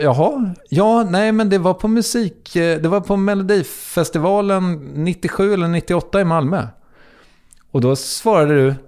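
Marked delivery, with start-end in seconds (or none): 0.0-6.7 high, hesitant imitation of the star; mock uncertain recall. Mimicking the star guessing where they met, high and hesitant.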